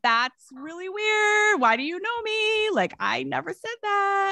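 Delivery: ordinary talk, nothing marked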